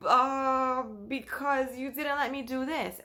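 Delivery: put-on high, slow hurt child voice; whiny little girl. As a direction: pout the line like a wounded kid. Child answers the nanny's questioning.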